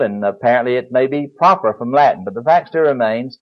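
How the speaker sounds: usual speaking voice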